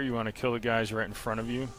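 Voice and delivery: ordinary delivery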